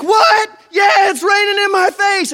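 high loud disbelief; incredulous shout. Retold or imitated. Disciples yelling back at Jesus in the rain, incredulous. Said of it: "And they're like".

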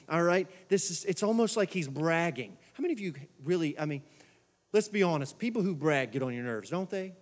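normal talking voice

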